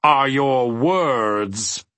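slow, drawn-out disdain; disdainful. A narrator's reading. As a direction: stretch the words out with contempt. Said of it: "handing Milo the last of them disdainfully".

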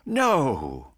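exasperated, sighing no. A narrator's reading. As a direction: sigh it out, fed up. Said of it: "with an exasperated sigh".

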